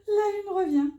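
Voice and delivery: thrilled the moon card is back; excited cry. Real, not acted.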